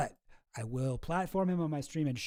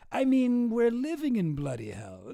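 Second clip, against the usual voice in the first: mock British accent; 6 semitones higher, 4 dB louder than usual. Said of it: "Is this guy British".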